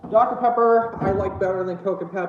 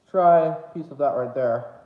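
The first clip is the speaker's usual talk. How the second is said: ordinary delivery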